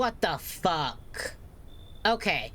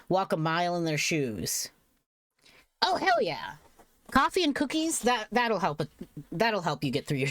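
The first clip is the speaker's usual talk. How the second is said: usual speaking voice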